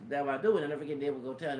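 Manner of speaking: ordinary talk, nothing marked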